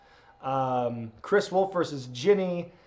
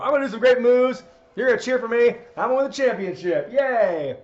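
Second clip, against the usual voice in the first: put-on higher, louder, childlike bragging; mock promo; 7 semitones higher, 5 dB louder than usual. Streamer mocks a kids' wrestling promo, higher and louder like an eager child. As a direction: gush like an eager kid wrestler.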